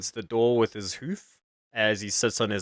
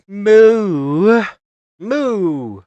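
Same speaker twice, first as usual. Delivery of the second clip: a loud, high cow's moo; 7 semitones higher, 9 dB louder than usual. Acted. Drunk cow mooing at his friends, loud moo.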